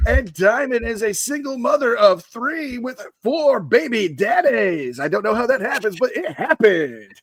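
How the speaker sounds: hammed-up DJ introduction with stretched-out names; Macho Man. DJ introduces Diamond and Sapphire to the guys, showman patter. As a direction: announce the acts like a showman, drawing out line endings. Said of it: "if the Macho Man was a D J".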